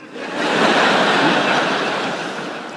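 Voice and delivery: breathy suppressed laugh; helpless laugh. Real, not acted. Comedian cracks up at his own joke about bread. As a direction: laugh almost silently.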